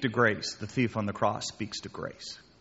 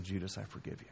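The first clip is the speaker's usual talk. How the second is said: much softer, gentle as if to a friend; tender forgiveness; 6 semitones lower, 15 dB quieter, faster than usual. Acted. Pastor voices Jesus forgiving Judas, softly tender. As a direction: hush it and let the forgiveness land.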